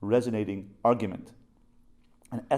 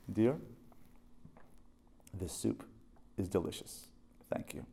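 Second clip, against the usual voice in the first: soft, gentle thanks; tender compliment; 3 semitones lower, 11 dB quieter than usual. Retold or imitated. Husband softly compliments his wife's soup, tender. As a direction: sweetly praise the soup to make peace. Peace-making compliment to wife.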